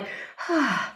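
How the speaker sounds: audible sigh of release; demonstrated sigh after a scare; relieved exhale. Shown as a demonstration. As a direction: let out a held breath. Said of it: "you let out a breath".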